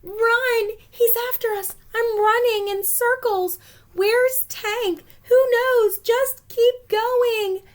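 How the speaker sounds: high, loud frantic cries; panicked shouting. Acted. Supplies panicking as Tankzilla chases them. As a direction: yell in panic while running.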